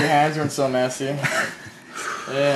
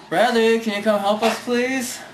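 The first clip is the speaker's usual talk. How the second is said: high, raised call for help; 7 semitones higher than usual. Real, not acted. Calling Bradley to come help, high and carrying. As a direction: summon someone over in a high singsong.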